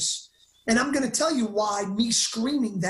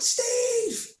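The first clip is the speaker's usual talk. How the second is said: much higher shouted name; mock call for a friend; 12 semitones higher than usual. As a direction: imitate calling the friend for help. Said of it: "I could have said".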